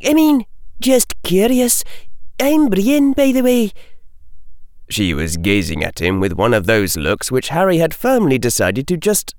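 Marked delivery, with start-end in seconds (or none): 0.1-3.7 much higher-pitched witch character voice; young witch. A narrator's reading. Witch introducing herself to Harry, high character voice.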